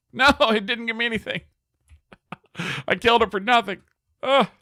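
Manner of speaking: high, loud wail of dismay with laughs; dismayed outcry. Real, not acted. Player who killed for no loot, high dismayed outcry. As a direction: cry out high in laughing protest.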